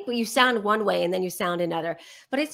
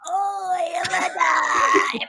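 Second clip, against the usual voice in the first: exaggerated greeting; 9 semitones higher, 4 dB louder, much slower than usual. Mock greeting to friend's voicemail.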